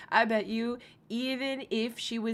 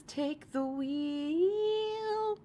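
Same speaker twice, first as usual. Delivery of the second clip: mock prayer; 9 semitones higher, much slower than usual.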